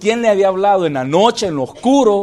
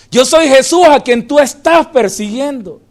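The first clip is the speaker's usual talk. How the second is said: louder, ringing proclamation; loud preached repetition; proclaimed second take; 7 semitones higher, 9 dB louder than usual. Acted. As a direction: declare it to the room. Same line repeated louder.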